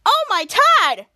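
slow, high, loud smug jab; sing-song know-it-all retort; mocking correction. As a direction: drag it out to tease him. Toddler mocking Kid Danger.

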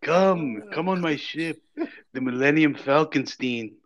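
hammed-up Yiddish alien; Yiddish accent.

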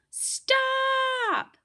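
very high, drawn-out interjection; commanding cry. A narrator's reading. New voice halting the arguing creatures, high drawn-out cry. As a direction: cut across the argument on one high, held word.

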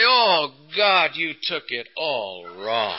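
slow, dismissive mock exasperation; drawn-out put-upon sigh; sarcastic routine. Husband brushing off wife, slow and sarcastic. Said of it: "I'd really go into my routine".

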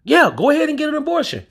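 a breezy, high put-on woman. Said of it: "talk like that".

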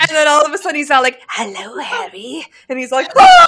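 excited. Acted. Voicing the Dark Lord vision.

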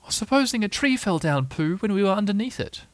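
high, worried little pig; Piglet. A narrator's reading. Little pig nervous under windy treetops, high worried voice. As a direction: pipe up with nervous what-if.

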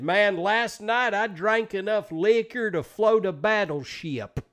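slow, high, loud Appalachian twang. Retold or imitated. Imitating a guy from the college dorm, slow Appalachian twang. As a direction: draw it out like an Appalachian boaster. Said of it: "was very Appalachian".

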